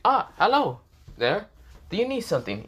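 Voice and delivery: sweeten it up, put on a cheerful mask. Issei greets the girl with a fake friendly smile, high and cheerful. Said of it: "said in a very cheerful tone".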